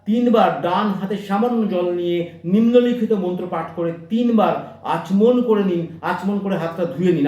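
ordinary talk, nothing marked

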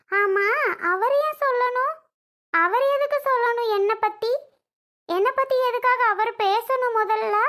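hurt and angry, throw the questions back; offended outburst. Acted.

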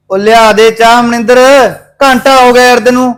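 impatient call across the house. Acted. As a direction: shout for Maninder to bring the tea. Husband calls wife for tea.

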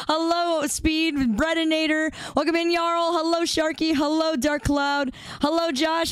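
high, chirpy hellos to each name; bright streamer greetings. Acted. Streamer greeting chat names one by one, high and chirpy.

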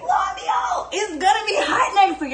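very high, delighted yell; excited shout. Retold or imitated. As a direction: announce the good news in a high cry. Reading the AccuWeather forecast.

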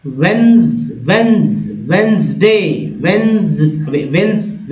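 "वेंसडे" drawn out as wens-day; model pronunciation. Shown as a demonstration. Teacher modelling the weekday for learners, model pronunciation. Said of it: "उच्चारण इस तरह से होता".

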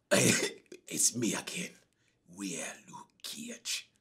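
very soft, slow, whispered mockery. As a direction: breathe it out like a lame villain. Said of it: "next scene".

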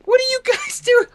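hammed-up disbelief at the crowd; mock outrage. Finding villagers crammed in one room, high shocked shout.

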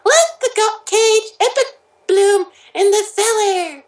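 very high, squeaky narration; high cartoon voice. Reading fan fiction to friends, squeaky cartoon voice.

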